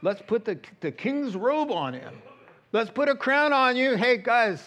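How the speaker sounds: loud, high jeering at the prisoner king; mocking taunt. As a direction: taunt him with rising glee. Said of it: "mocked him".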